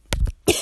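sharp unvoiced cough from choking. Real, not acted. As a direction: cough out the choke. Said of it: "started choking on my own spit".

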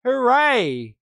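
high-pitched, hammed-up gleeful cheering; exaggerated fake jubilation, loud at the start; mock celebrating. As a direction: squeal like an overjoyed fan, sarcastically. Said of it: "when people are celebrating".

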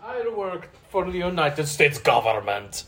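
hammed-up Indian phone scammer; put-on Indian accent, official claim; mock Indian accent. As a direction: play a scam caller with an Indian accent. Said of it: "I hear some Indian accent".

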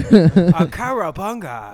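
loud, slow, laughing surfer cowabunga; drawn-out surfer shout. Pretend. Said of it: "give me a Chinese surfer voice".

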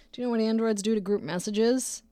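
ordinary talk

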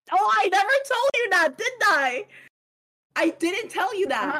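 high, loud sudden realisation; oh wait, I never told you; surprised realisation. Real, not acted. Sudden realisation mid-story.

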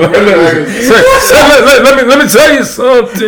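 brash comedian impression breaking into laughter; blustering stand-up catchphrase; Bernie Mac. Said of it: "You sounded just like Bernie Mac".

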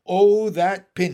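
loud, slow, relishing croaker; loud raspy frog voice; raspy bullfrog. A narrator's reading. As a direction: gloat as a raspy old bullfrog. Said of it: "in his raspy voice".

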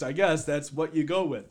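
normal talking voice

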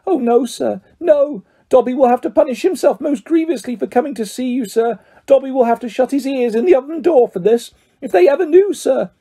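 high, dread-filled elf; Dobby, fearful. A narrator's reading. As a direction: squeak anxiously about punishment. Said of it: "Dobby shuddered".

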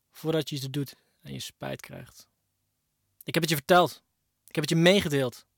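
ordinary talk

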